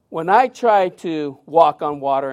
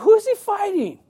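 much higher bewildered inner question; baffled; 14 semitones higher than usual. Retold or imitated. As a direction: ask it in total disbelief. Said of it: "And I'm thinking".

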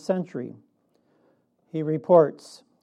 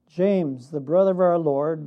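ordinary talk

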